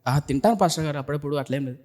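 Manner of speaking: small, low, very soft reply; imitated meekness. Girl answering the pastor about skipped meals, meekly. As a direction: play a downcast girl, voice dropping away. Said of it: "ఆ అమ్మాయి దీనంగా ముఖం పెట్టింది".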